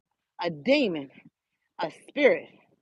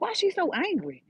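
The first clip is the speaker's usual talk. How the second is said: bewildered; 9 semitones higher, 4 dB quieter than usual. Retold or imitated. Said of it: "I'm looking at her like".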